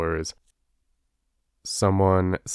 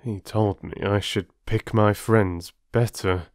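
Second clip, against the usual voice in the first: grave; slower than usual. Acted. Older traveller delivers the moral.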